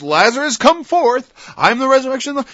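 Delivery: high, loud call to the dead; Jesus calling Lazarus forth with a loud cry; commanding Jesus. Acted. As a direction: summon Lazarus out of the tomb. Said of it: "Like Jesus said to Lazarus".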